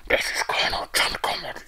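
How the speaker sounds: harsh whispered radio-message voice; John Conrad. Acted. Player reading the colonel's message aloud, croaky whispered character voice. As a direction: rasp it out as a put-on commander. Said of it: "I'm gonna have to do the voice".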